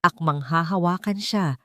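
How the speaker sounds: usual speaking voice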